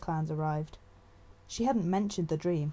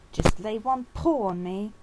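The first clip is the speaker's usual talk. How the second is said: slow, louder threat; menacing dare; 3 semitones higher, 6 dB louder than usual. A narrator's reading. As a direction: dare her to try it. Bristling apprentice dares warrior.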